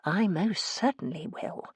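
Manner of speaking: soft, slow, resolved aside; private thought. A narrator's reading. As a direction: say it under your breath, deliberately. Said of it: "I thought".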